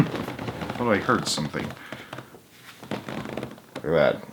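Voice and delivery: soft aside. Real, not acted.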